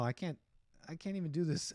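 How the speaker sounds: ordinary talk, nothing marked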